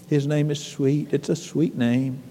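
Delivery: low, soft close after singing; quiet reverence. Real, not acted. Preacher summing up the sweet name after singing, quiet reverence. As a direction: bring it right down, tenderly.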